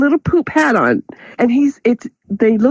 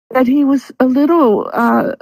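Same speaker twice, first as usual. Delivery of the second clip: usual speaking voice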